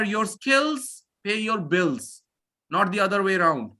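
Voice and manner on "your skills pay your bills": your skills pay your bills, said slowly for weight; slow emphatic saying. Real, not acted. Teacher giving students his motto, slow and emphatic. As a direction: drive the motto home slowly. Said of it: "Always remember".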